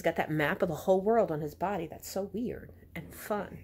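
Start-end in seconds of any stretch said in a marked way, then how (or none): 1.9-3.6 whispered. Real, not acted. Aside to listeners.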